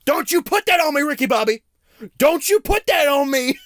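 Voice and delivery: angry shout. Retold or imitated. Man in hospital scene yelling angrily at Ricky Bobby. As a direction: yell it back at Ricky, furious.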